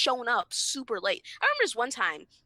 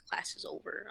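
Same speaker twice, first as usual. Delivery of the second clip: whispered; 10 dB quieter than usual. Real, not acted. Wanting to jump when class ends.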